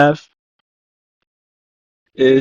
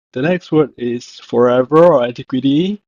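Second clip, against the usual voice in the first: usual speaking voice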